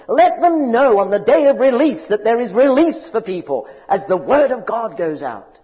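loud, high, impassioned preaching; preaching at full force. Acted. Preacher proclaiming scripture to the congregation, loud impassioned preaching. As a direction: thunder it out like a revival preacher.

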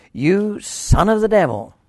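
loud, high, forceful denunciation; strong rebuke. Acted. Paul rebuking the magician Elymas, loud and forceful. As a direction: thunder it at the sorcerer. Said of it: "he's glaring and he's speaking strongly".

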